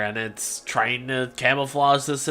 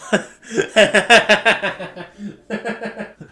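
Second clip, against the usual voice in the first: long, loud laughing fit; cracking up; 8 semitones higher, 7 dB louder than usual. Real, not acted. Speaker cracking up at own impression, helpless laugh. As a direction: burst out laughing at your own bit.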